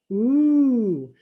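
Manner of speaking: loud, long-drawn ooh; awed fireworks ooh; crowd's ooh. A narrator's reading. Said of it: "Everybody goes".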